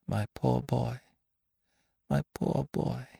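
high, soft, grieving murmur; stammered pity. A narrator's reading. Victoire to bereaved Lupin, soft stammered pity. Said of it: "Victoire stammered".

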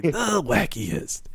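slow, soft drawn-out imitation; Montaigne. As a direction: stretch both words out, softly mimicking a character. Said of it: "I like hearing Montaigne say".

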